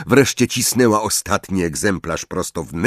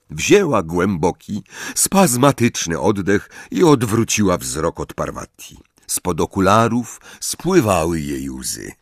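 ordinary delivery

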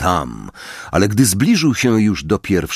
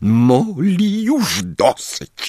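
calm firmness; 9 semitones higher, much slower than usual. Acted. Lupin calms her.

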